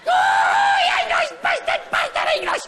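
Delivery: very high-pitched shouted yapping; yappy terrier Scotsman. Acted. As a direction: yap like an angry little Scottish terrier. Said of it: "your little yappy terrier jock".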